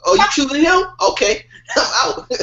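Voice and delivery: mock jilted guy. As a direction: playfully concede the girl and walk away. Acting out losing the girl.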